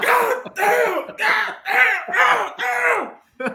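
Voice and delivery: high shouted God damn over and over; mock yelling. Friends reenacting a wrestling crowd, loud mock yell.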